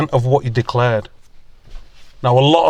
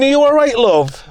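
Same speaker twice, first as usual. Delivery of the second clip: higher and louder, calling from far off; shouting across the road; 10 semitones higher, 4 dB louder than usual. Retold or imitated. Neighbours shouting to a fallen granny from across the road. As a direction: call it across a busy road. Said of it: "shouting to her from across the road".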